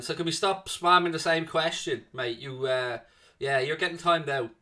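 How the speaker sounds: normal talking voice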